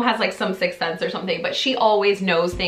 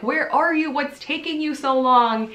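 higher-pitched mock impatience; impatient questions, pitched up; 7 semitones higher than usual. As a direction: retell nagging someone to hurry up. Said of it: "of course I'm impatient".

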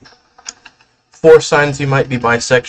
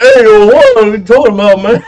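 high, loud mimicked crowd reaction; hammed-up high-pitched shouting; mock outraged shout; 11 semitones higher, 8 dB louder than usual. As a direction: yell it high like the indignant meme crowd. Imitating meme's crowd reacting.

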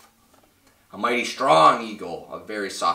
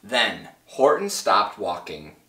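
ordinary delivery

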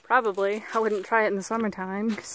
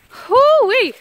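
much higher, loud joyful holler; excited whoop; 14 semitones higher, 12 dB louder than usual. Real, not acted. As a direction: cheer at the view. Reaching the top, seeing view.

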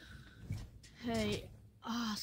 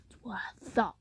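normal talking voice

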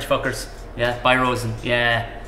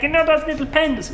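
eager idea man; 13 semitones higher than usual. Acted. Peter pitching idea.

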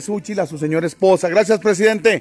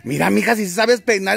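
ordinary talk, nothing marked

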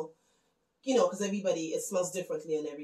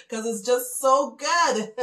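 laughing, louder and slow; 5 semitones higher, 9 dB louder, slower than usual. Real, not acted. Joking to viewers about hiding the sorrel, laughing. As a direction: giggle through the confession.

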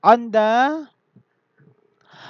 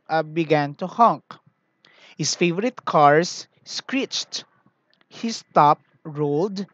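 story narration; 4 dB louder than usual. A narrator's reading. Teacher reads story to class.

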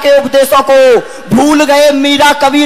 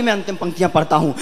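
normal talking voice

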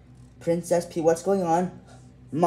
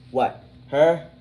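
loud startled question; shocked disbelief; 9 dB louder than usual. Acted. Friend hearing the evil princess did it, loud startled disbelief.

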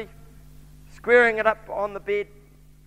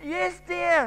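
strained yes, dear pitched up high; wife's voice climbing higher in dismay; voice rising high; 7 semitones higher than usual. Acted. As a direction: squeak it out as the nerves climb. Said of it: "her voice getting higher and higher".

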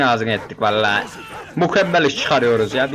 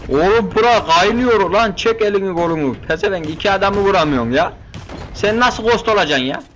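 frantic outburst; 6 semitones higher than usual. Real, not acted. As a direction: yell at the useless teammate in the chaos. Frantic firefight, scolding teammate. (yüksek sesle sinirli, telaşlı çıkış)

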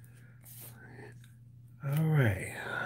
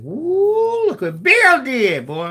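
mock amazement; 11 semitones higher, 5 dB louder, much slower than usual. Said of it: "You're gonna be like".

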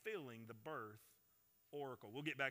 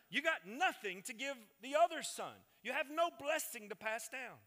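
higher, emphatic preacher's delivery; emphatic sermon voice, pitched up; forceful preaching; 8 semitones higher, 6 dB louder than usual. Real, not acted. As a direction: drive the argument home with a raised voice.

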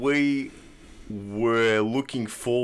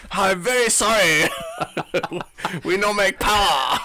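mock apology; 8 semitones higher, 4 dB louder than usual. Mock Yamaha engineers' apology.

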